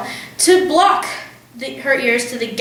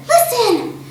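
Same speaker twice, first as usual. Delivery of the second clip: urgent; 11 semitones higher than usual. A narrator's reading. Matilda urging quiet.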